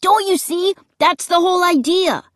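high, eager exclamation; excited cry. A narrator's reading. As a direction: cry it out as if it's obvious. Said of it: "Luke cried".

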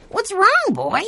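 put-on high, concerned little boy; a small boy worried for his dog; young boy voice. A narrator's reading. Benny talks to worried dog.

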